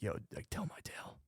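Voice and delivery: very soft, breathy half-whisper of awe; hushed mock admirer. Imagined people asking for his legend, hushed. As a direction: play an impressed fan under your breath. Acting out awed future admirers.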